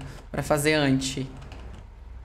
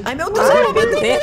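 high, loud panicked "ai, meu Deus"; alarmed shriek; 9 dB louder than usual. Acted. Roleplay mom panics at her baby, high loud shriek. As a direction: shriek in mock panic as the kid misbehaves.

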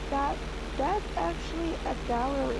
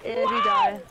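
excited shout; 5 dB louder, slower than usual. Real, not acted.

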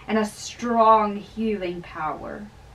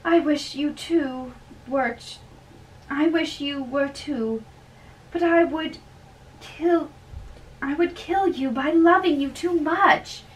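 high, loud and longing; lovesick young woman; 6 semitones higher, 6 dB louder than usual. Acted. Young woman calling goodnight to her lover, high and loud. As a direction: pour out love to him, voice lifted high.

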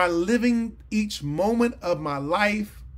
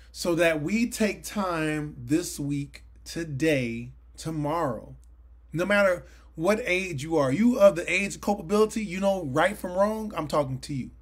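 normal talking voice

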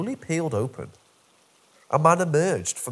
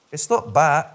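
insistent; 3 semitones higher, 6 dB louder than usual. Acted.

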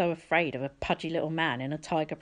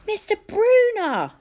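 high, slow, loud call of recognition; a joyful shout across the room; cried out; 8 dB louder, slower than usual. A narrator's reading. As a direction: call out his name in glad surprise. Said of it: "I cried".

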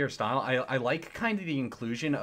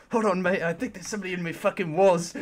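put-on British accent; 6 semitones higher than usual. Guest tweaking mid-interview, paranoid British accent. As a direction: play a tweaking, paranoid Brit. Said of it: "The British accent made that for me".

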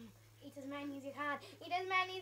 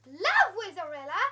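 "Love Wizarilla?" much louder, rising in disbelief; shocked loud question on the sign; surprised question; 4 semitones higher, 18 dB louder than usual. Real, not acted.

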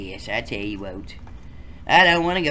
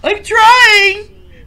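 whiny shout; 14 dB louder than usual. Acted. Whiny kid response.

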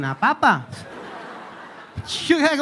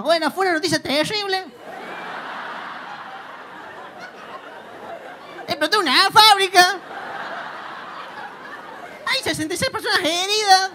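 put-on Córdoba accent; 8 semitones higher than usual. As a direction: tell a tragedy like a joke in Cordobés. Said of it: "el tipo estaba como". (presentador cordobés, tono de chiste)

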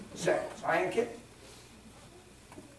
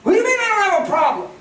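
indignant retort; 12 dB louder, faster than usual. Retold or imitated. Voicing indignant man, loud.

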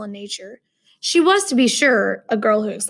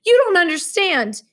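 higher, louder impatient cut-in; 8 semitones higher, 4 dB louder than usual. Acted. Roommate interrupting the lecture, impatient and louder. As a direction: cut him off, exasperated. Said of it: "he interrupted impatiently".

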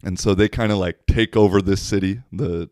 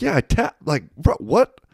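higher, disbelieving retort; incredulous; 9 semitones higher, 4 dB louder than usual. Retold or imitated. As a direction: protest in disbelief. Speaker's retold reply.

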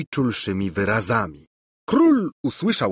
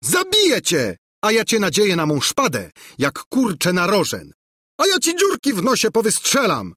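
high-pitched, hot-headed ranting; hammed-up duelling fury; furious threats; 11 semitones higher, 4 dB louder than usual. A narrator's reading. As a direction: threaten your rival in a theatrical rage. Princes trade furious death threats.

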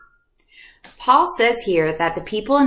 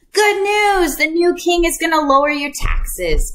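herald's announcement; 8 semitones higher than usual. Retold or imitated. Messenger announcing the new king's gifts, herald cry. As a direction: announce it like a town crier. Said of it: "they would say".

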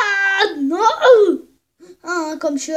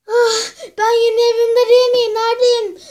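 confused outcry; 5 semitones higher, 7 dB louder than usual. Acted. Waking confused in strange place.

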